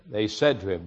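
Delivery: ordinary talk, nothing marked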